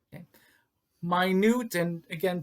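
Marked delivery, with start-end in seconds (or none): 1.0-1.8 "Minute" as my-NOOT, pitched up; careful pronunciation. Shown as a demonstration. Teacher drilling the new word for students, careful pronunciation. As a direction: say "Minute" with long I and stressed NOOT. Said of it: "pay attention to the pronunciation".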